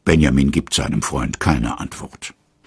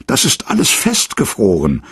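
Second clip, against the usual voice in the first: disappointed call; 6 semitones higher than usual. A narrator's reading. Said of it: "ruft Karl enttäuscht".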